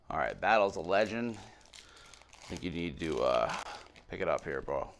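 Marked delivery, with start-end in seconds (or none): none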